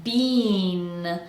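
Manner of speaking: "bean" with a stretched-out ee; long vowel. Shown as a demonstration. Coach asks for a long vowel, drawn-out bean. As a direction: hold the ee in "bean". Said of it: "Make that vowel nice and long".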